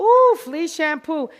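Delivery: high, loud, drawn-out ooh; pleased surprise at the question. Real, not acted. Groomer reacting to a student's question, surprised ooh.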